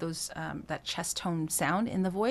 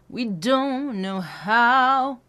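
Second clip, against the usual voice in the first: slow sung, lean into how; 3 semitones higher, 6 dB louder, much slower than usual. Shown as a demonstration. Coach demonstrating lean on how. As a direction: press into how. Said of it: "adding a lean into the word how".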